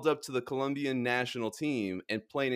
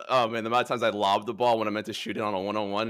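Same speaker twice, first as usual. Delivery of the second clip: usual speaking voice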